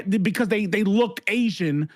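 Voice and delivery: ordinary talk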